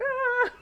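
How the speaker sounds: high-pitched pretend "ah" of overwhelm; mock panicked cry. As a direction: squeal as if swamped, in jest. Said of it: "We're gonna be like".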